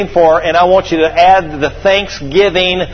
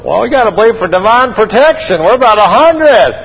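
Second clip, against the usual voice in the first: high, loud comic protest; mock alarm; 5 semitones higher, 4 dB louder than usual. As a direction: protest in exaggerated disbelief, peaking at the end. Acting the aged couple's alarmed reaction.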